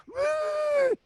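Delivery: very high whooped whistle imitation; mock whistle blast. Co-host mimicking his friend blowing a whistle at a bear, high mock whistle. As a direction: hoot like a panicked whistle-blower. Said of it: "you with a whistle in your mouth going".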